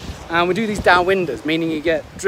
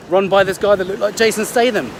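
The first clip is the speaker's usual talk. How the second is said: ordinary talk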